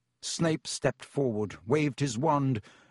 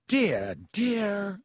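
fussing teacher; 7 semitones higher than usual. A narrator's reading. Flustered teacher surveys duel damage.

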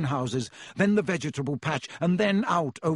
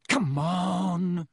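drawn-out coaxing plea; cajoling; 3 semitones higher than usual. A narrator's reading. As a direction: coax it along, stretching the words. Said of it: "Rod said cajolingly".

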